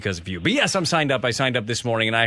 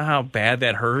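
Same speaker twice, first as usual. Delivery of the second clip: usual speaking voice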